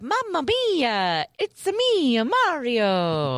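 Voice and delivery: put-on high, slow Italian Mario accent. Quoting Mario in the movie, high slow Italian accent. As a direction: stretch out mamma mia like Mario. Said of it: "lots of".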